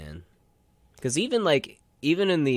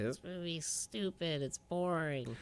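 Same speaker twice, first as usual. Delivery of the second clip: put-on higher, softer childish complaint; mock kid voice, slow; little kid whining; 7 semitones higher, 10 dB quieter than usual. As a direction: sulk like a bored kid. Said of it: "I was like".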